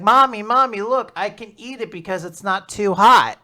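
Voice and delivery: a hint of a kid calling to mommy; little girl. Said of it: "a little girl who's there".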